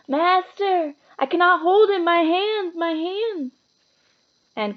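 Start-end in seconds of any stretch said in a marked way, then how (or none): 0.1-3.5 high anguished plea; pained cry. A narrator's reading. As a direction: cry out as the hands burn. Quirrell in agony.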